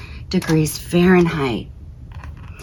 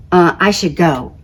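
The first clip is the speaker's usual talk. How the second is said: plain reading voice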